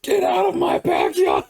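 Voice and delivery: imitating mom's high, loud charging yell; retold yell. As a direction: holler it like mom charging at intruders.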